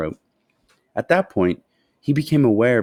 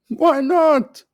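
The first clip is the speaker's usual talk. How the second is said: high, louder jovial shopkeeper; cheerful shopkeeper; 6 dB louder than usual. A narrator's reading. Fat shopkeeper cheerfully agreeing to sell another bar, high and loud. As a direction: toss it off like a jolly shopkeeper. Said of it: "the fat shopkeeper said".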